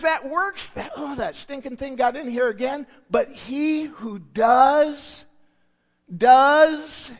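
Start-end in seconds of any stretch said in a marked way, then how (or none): 3.1-7.1 "does" drawn out slowly, then hit loud; stress on does. Real, not acted. Preacher reading the verse to the church, "does" stressed. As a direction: lean hard on "does" both times.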